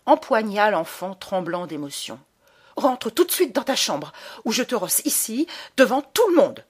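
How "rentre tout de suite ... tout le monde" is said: choked, higher threat in a tight voice; strangled voice. A narrator's reading. Mother threatening her son in front of everyone, strangled. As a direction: hiss a threat through a tight throat. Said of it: "d'une voix étranglée".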